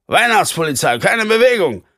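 shouted through a megaphone, high and loud; toy megaphone. A narrator's reading. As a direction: bark the order through a bullhorn. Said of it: "brüllte einer der Polizisten durch ein Megafon".

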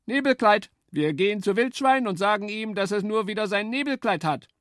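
eager high-pitched woodpecker. A narrator's reading. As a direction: chirp it out like a keen woodpecker. Woodpecker speaks.

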